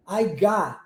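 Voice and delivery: "got" with an open A-like vowel; O sounded as A. Shown as a demonstration. Teacher showing the linguistic pattern, "got" with O as A. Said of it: "o O com som de A".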